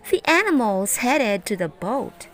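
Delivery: ordinary delivery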